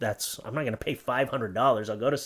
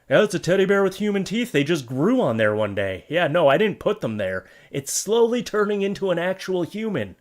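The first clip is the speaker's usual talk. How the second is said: mock seller; 5 semitones higher, 4 dB louder than usual. Playing the seller.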